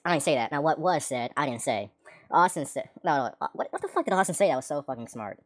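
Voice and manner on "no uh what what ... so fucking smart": ordinary talk, nothing marked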